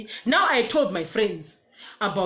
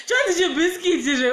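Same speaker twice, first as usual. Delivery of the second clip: laughing hard, higher; 9 semitones higher, 5 dB louder than usual. Real, not acted. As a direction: crack up on the name. Laughing at the nickname.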